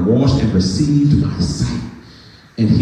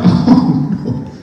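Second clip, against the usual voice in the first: mock whimper. Retold dread at needle.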